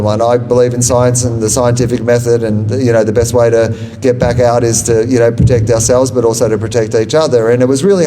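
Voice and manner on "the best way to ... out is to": ordinary talk, nothing marked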